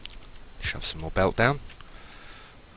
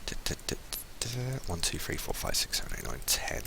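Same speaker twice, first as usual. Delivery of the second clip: muttering under breath; 12 dB quieter than usual. Real, not acted. Counting to self under breath.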